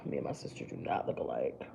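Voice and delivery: a quick whispered aside to the viewers. Real, not acted. Makeup vlogger whispering a joke to viewers, whispered aside. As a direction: breathe it out as a private joke. Aside after quoting saying.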